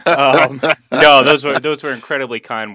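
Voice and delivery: loud laughing protest, high. Acted. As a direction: blurt it out loudly, mock indignant. Joking protest at the praise.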